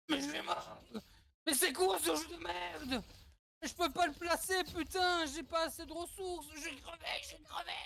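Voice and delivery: high-pitched, frantic gamer panic; panicked whine. Pretend. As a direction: whine in a rising panic as you lose. Panicking as the character dies.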